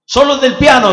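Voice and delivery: high, loud order; commanding call. Acted. God picking only the piano angel, loud command. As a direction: call out to the whole band.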